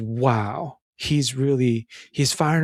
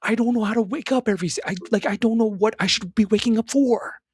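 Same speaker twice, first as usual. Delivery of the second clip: desperate plea; 9 semitones higher than usual. Retold or imitated. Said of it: "Nick is saying".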